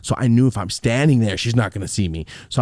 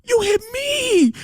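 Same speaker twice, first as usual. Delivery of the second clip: mock wail; much slower than usual. Mocking mom's fake victim claim.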